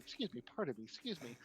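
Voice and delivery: very soft, polite excuse-me; sneaky polite murmur. Retold or imitated. Humans sneaking through a crowded room, very soft politeness. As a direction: sneak past, barely voiced. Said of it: "trying to sneak by going".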